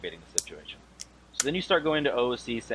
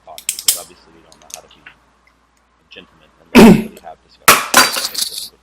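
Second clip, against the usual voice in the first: angry outburst; 5 semitones lower, 17 dB louder than usual. Real, not acted. Heated guild argument, sarcastic outburst.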